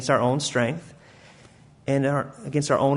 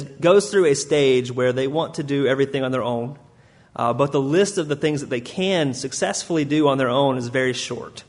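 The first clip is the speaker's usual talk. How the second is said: ordinary talk, nothing marked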